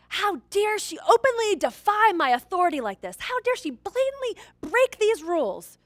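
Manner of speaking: mock-furious dad muttering to himself; angry dad thoughts. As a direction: seethe at the broken rules. Dad's angry inner voice.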